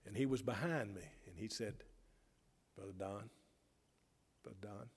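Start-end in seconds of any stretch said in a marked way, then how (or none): 2.7-4.9 a soft, faint call of "Brother Don"; softly voiced frail old man calling; weak voice. Frail friend calling the pastor back, weak and soft. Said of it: "his voice was just so weak".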